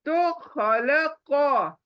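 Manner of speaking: खलक drawn out with the final stop held; stopping on खलक; pausal stop. Shown as a demonstration. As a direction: say खलक very slowly, ending on a held k. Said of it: "इसको कभी भी एंड में नहीं पढ़ना चाहिए".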